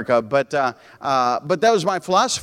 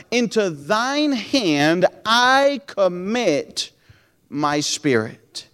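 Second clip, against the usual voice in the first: slow solemn reading of the verse; scripture reading; much slower than usual. Acted. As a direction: read it like a prayer.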